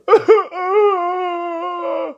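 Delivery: high wheezing laugh; crying laughter. Real, not acted. Cracking up at his own joke, high wheezing laugh. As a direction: break down laughing.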